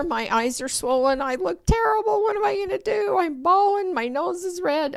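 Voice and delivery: high, frantic self-talk; panicked inner voice. Retold or imitated. Mother panicking over her swollen eyes before opening the door, high and frantic. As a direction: squeak out your panic at being seen like this.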